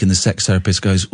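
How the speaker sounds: ordinary talk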